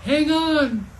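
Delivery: loud, high drawn-out warning yell; big storybook shout. A narrator's reading. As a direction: yell it over the wind. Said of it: "Sawyer yelled".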